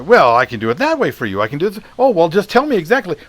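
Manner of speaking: berating Shatner. Retold or imitated. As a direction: talk down to the kid. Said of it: "he kinda just berates the guy".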